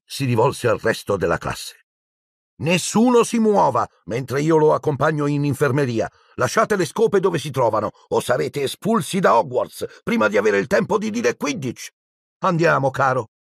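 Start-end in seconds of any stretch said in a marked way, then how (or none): 2.6-11.9 higher, firm teacher voice; stern warning. Acted. Madame Hooch warns the class not to move, stern and higher. 12.4-13.3 gentle coaxing. Acted. Madame Hooch gently leads the injured Neville away. Teacher comforts boy.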